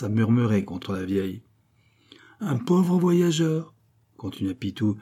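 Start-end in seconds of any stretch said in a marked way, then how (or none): 2.4-3.7 high, slow, drawn-out plea for pity; pathétique. A narrator's reading. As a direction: present yourself grandly as a poor victim. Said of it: "assez de pathétique". (apitoiement déclamé, aigu et lent)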